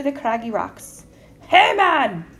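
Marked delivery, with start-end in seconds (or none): 1.5-2.3 loud, high troll shout; roared challenge. A narrator's reading. Troll catching a goat on his bridge, roaring. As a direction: roar it at the trespasser. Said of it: "roared the Calypso Joe".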